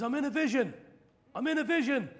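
high, loud prophetic declaration; ecstatic vision announcement. Retold or imitated. Evangelist telling the crowd he is in a vision, high ecstatic.

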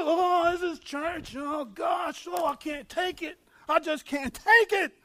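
high, slow put-on whine; mock wailing complaint. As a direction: imitate an overwhelmed churchgoer moaning. Mocks churchgoers who complain and cry.

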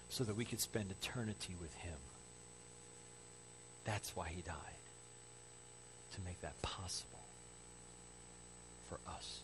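very soft, low, solemn voice; reverent, barely voiced sermon close; hushed preaching. Real, not acted. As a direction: sink into a near-whisper, with long pauses.

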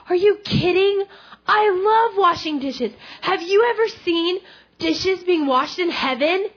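high, loud, gushing enthusiasm; thrilled retold outburst, pitch way up; freaking out with excitement. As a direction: act out overjoyed disbelief, stretching key words. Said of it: "he's freaking out".

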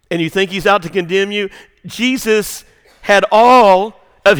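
loud, rising preacher's cry to the congregation; preaching at full force. Acted. Preacher to congregation on the disciples abandoning Jesus, loud preaching.

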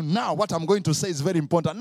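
loud, quick proclamation; hammed-up, hurried heads-up; mock announcement. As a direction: announce it with big importance. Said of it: "he will never tell you".

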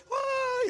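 high, loud imitated crowd cheer; crowd whoa. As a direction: echo the roar of a distant crowd.

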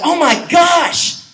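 high, slow, drawn-out oh my gosh; retelling his shock when Siri popped up; startled gasp. Retold or imitated. Pastor acting out his surprise at his phone, high and drawn out. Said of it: "I was like".

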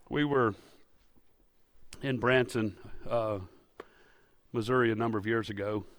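ordinary delivery

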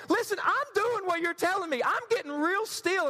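high-pitched, insistent self-defence; exasperated protest. Acted. Young believer defending himself to his advisers, exasperated. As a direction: plead your case high and comically frustrated.

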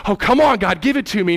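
acted eager plea, rising high; excited begging. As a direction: beg like you can't wait to have it. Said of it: "Adam's excited".

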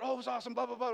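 high-pitched mock enthusiasm; mocking gushing. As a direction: parody their excited chatter, dismissively. Mocks others' excited chatter.

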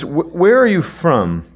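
high, loud, stammered question; afraid. Acted. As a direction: act out Pilate's alarm, stumbling on the first word. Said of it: "he was even more afraid".

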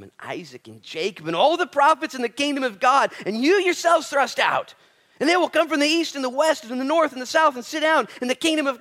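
loud, high, ringing sermon voice; full-force preaching. Acted. Preacher driving the point home to his congregation, loud and high. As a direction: preach it out to the whole room.

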